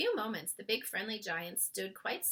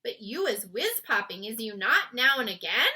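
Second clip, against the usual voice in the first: louder teasing giant; 8 dB louder than usual. A narrator's reading. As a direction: coax her, giant-style. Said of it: "asked the B F G".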